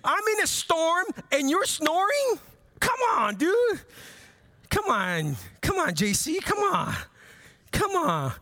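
high, incredulous scolding of a sleeping friend; mock exasperated complaint. Pastor playfully scolding a snoring Jesus, high and exasperated. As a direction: whine at Jesus like he let you down. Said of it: "and I'm like".

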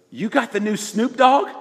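a friend's loud, high, keen question; excited friend. Retold or imitated. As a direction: play the pumped-up friend showing off a disc. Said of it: "and he goes".

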